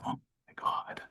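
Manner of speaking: hushed, whispered horror; very soft acted dismay, voiceless; retold dismayed whisper. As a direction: whisper it as the mistake sinks in. Acted horror at own mistake.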